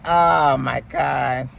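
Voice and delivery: ordinary delivery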